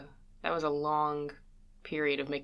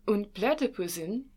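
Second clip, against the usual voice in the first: mock German; 5 semitones higher than usual. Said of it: "making fun of German accents".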